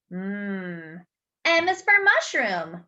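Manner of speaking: ordinary talk, nothing marked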